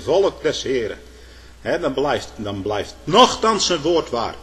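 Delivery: usual speaking voice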